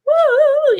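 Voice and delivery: high excited cheer like a compere; compere whoop. Retold or imitated. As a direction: throw out a big showman's woo.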